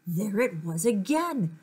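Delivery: ordinary delivery, nothing marked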